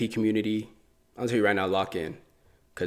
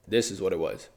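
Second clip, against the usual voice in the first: usual speaking voice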